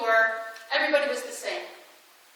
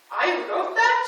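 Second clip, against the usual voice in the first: voiced as a startled question, higher and louder; dad's surprised question; 6 semitones higher, 3 dB louder, slower than usual. Dad to daughter hearing his own poems, surprised question. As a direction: imitate Dad's disbelief, rising at the end. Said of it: "what does he say to me".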